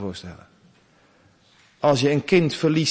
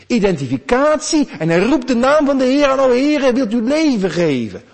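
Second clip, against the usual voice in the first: high, loud, rising sermon climax; preacher lifting the whole room, loud and high; preaching at full force; 10 semitones higher, 8 dB louder than usual. Acted. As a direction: drive the message home to the congregation. Fervent preaching of the prayer.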